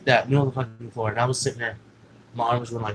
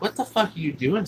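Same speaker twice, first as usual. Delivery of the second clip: higher-pitched indignant demand; outraged confrontation; 7 semitones higher than usual. Retold or imitated. Stepmother catching dad punishing his kid, retold outrage. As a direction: imitate a shocked, angry challenge.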